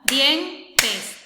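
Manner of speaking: dientes dicho marcando el golpe de palmada; di-en-tes con palmada fuerte; clapped syllables. Shown as a demonstration. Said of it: "Entonces damos palmada". (dientes marcando sílabas con palmadas)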